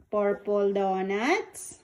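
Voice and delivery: put-on "Purple donuts" slow, each word drawn out for the child; slow naming for a toddler. Shown as a demonstration. Parent teaching child the words, slow and clear. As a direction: model the words slowly so she can copy. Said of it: "You say it".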